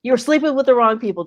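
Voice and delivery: quick loud tease; teasing retort. Real, not acted. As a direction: fire it back fast and loud.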